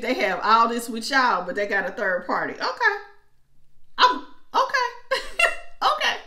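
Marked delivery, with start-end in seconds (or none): 2.5-6.2 much higher, laughing okays; giggling through the okays; amused laugh. Real, not acted. Reader cracking up at the reading.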